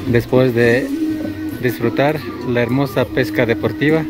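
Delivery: ordinary talk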